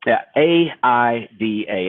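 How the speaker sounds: A I D A spelled slowly, each letter apart; slow spelled-out acronym. Shown as a demonstration. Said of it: "let's talk about the aida approach".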